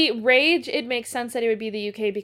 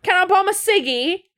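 mock British geezer accent, much higher and louder; 9 semitones higher, 7 dB louder than usual. Friends riffing on British slang, loud mock British accent. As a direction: ham up a cheeky London voice. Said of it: "New accent unlocked".

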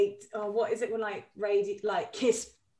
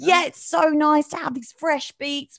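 louder, higher South London DJ patter; radio DJ; 6 semitones higher, 6 dB louder than usual. Acted. Guest parodying Kiss presenters, hyped DJ voice. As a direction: hype the tunes like a station presenter. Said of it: "they're like".

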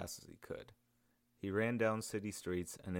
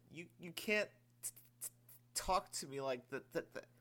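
put-on much higher, stammering young voice; stuttering; 9 semitones higher than usual. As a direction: stumble over the words, shaken. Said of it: "The sergeant stuttered".

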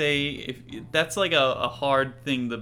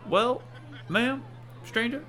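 higher-pitched folksy townsman; 7 semitones higher than usual. Acted. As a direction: play a stubborn small-town man, pitched up. GM voices the beef-loving townsman.

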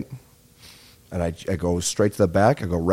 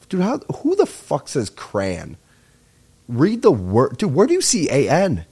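exasperated rant; 5 semitones higher, slower than usual. Real, not acted. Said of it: "ranting at crayon sayers".

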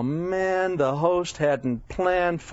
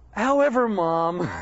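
higher-pitched, acted mild protest; playful resistance; 6 semitones higher, slower than usual. Jesus answering his mom, mildly resistant. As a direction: begin as a son humouring his mother. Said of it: "he was a little resistant".

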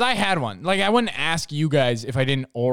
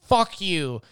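higher, louder imitated angry retort; mock-angry comeback, pitched up; mock fighting; 3 semitones higher, 4 dB louder, much slower than usual. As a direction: act out a drunk squabble. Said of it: "they, like, started fighting".

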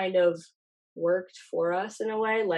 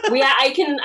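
laughing; 8 semitones higher, 7 dB louder than usual. Real, not acted. Laughing reply.

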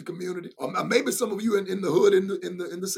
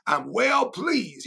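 slow preached refrain; much slower than usual. Real, not acted. Preacher repeats God's words.

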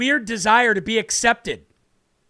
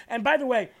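ordinary talk, nothing marked

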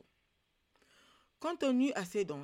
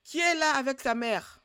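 loud, higher, scared yelling; shouting insults; 7 semitones higher, 13 dB louder than usual. A narrator's reading. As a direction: cry out in fear and insult the dark. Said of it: "il cria en injuriant".

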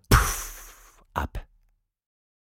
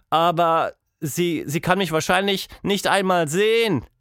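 put-on a girl making a nervous excuse; lightly voiced worried girl; 7 semitones higher than usual. A narrator's reading. Tilda to Quatschmonster, a lightly voiced nervous excuse.

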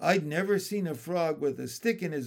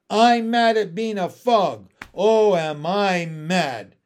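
angry frog; 3 semitones higher, 9 dB louder, slower than usual. Acted. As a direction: rant it out furiously. Frog ranting about being frog.

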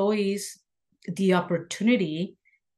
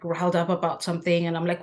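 ordinary talk

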